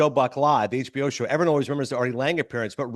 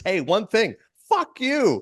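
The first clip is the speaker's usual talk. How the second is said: mock insult; 8 semitones higher, much slower than usual. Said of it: "turns to Tim McCarver and says".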